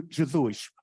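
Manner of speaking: usual speaking voice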